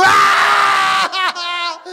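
pain yell. Retold or imitated. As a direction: let the prank shout turn into agony. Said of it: "my yell changes".

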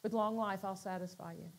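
soft little voice. Retold or imitated. The still small voice promising life, soft and low. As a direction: let it barely float out. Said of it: "this little soft voice".

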